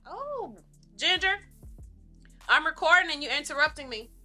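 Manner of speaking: high, loud call to the dog; scolding the dog. Real, not acted. Dog interrupts the recording.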